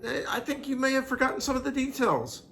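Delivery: very high, sly teasing; fiancé teasing she forgot details; mock gentle teasing. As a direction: tease her sweetly about forgetting.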